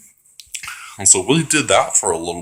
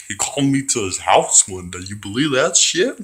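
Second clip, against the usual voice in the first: ordinary talk, nothing marked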